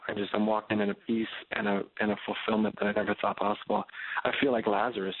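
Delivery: ordinary talk, nothing marked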